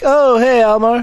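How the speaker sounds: high, loud fake-surprised hello; feigned casual greeting. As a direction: act as if just strolling by. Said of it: "like, a casual stroll".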